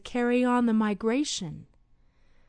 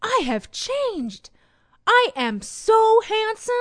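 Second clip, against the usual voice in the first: high, slow, boastful character voice; proud young butterfly; 10 semitones higher, 5 dB louder, slower than usual. Acted. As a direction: preen over your new looks. Marco admires himself.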